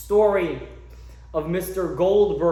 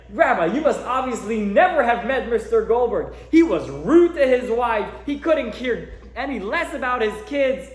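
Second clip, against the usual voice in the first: indignant heckle; 7 semitones higher, 7 dB louder than usual. Retold or imitated. Old man heckling again.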